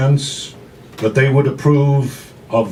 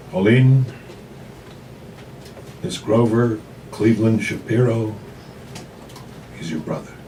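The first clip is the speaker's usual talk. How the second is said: grave mother; 2 semitones lower, 6 dB quieter than usual. Acted. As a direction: break the news gently, falling away. Mother reveals Grover is brother.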